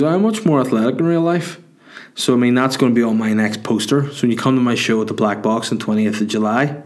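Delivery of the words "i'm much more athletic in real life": high, mimicked punter voice; drunk guy. Comedian quoting the drunk fella's remark, high put-on voice. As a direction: parrot the drunk fan's line up high. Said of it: "But this guy goes".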